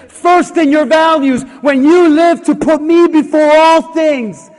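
loud, emphatic, hammering each first; commanding God. Acted. God telling the congregation to put him first, booming. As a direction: declare it like a proclamation. God's demands voiced, preaching.

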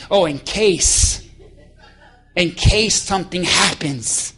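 slow, knowing drag on case; mock cautious. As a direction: draw it out like a smug planner. Mocking the chess person.

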